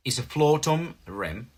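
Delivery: normal talking voice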